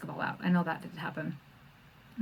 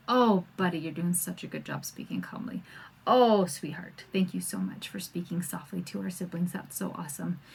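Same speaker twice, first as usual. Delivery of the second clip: put-on much softer, gentle praise to a child; tender praise; 4 semitones lower, 6 dB quieter than usual. Shown as a demonstration. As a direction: coo praise softly to your little one. Praising child for speaking calmly.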